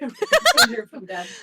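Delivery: slow, laughing delivery. Acted. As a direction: laugh through a ghostly boast. Player jokes character returned, laughing.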